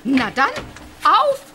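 loud, eager "let's go"; rally the team forward; rousing call. Acted. Urging the friends to go.

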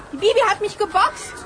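usual speaking voice

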